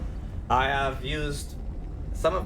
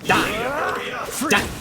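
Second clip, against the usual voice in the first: furious yelling at the screen; crying with rage; 6 semitones higher, slower than usual. Real, not acted. Raging at enemy in fight.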